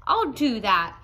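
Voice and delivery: loud screech; grouchy ladybug screeching back, loud. A narrator's reading. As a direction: snap it out loudly. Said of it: "screeched the grouchy ladybug".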